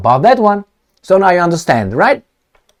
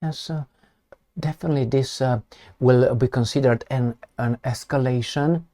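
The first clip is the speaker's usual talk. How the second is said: much softer, mumbled official drone; mock Peskov; 4 semitones lower, 12 dB quieter than usual. Mocking Peskov's timid official statement, soft and droning. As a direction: imitate a limp Kremlin spokesman, barely audible. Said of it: "I see Peskov coming with his mustache".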